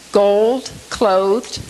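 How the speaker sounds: "gold, clothed" with each O held long; long O sounds. Shown as a demonstration. Teacher picking out sounds in the verse, long O sounds. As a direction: set "gold" and "clothed" apart, stretching the O. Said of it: "The long O sounds".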